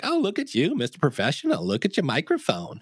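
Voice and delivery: high, gushing mom voice; sky-high proud mom impression; doting mom. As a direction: coo at him like a proud mother. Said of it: "she's like".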